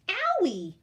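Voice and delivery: high, loud yelp of pain; a little bear yelping at hot soup; Baby Bear yelps as breakfast burns mouth; yelled. A narrator's reading. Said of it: "yelled Baby Bear".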